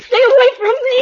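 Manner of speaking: shrieked high and very loud; terrified scream. Acted. Kitty screaming as Nicky closes in with a knife. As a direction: scream at him in terror.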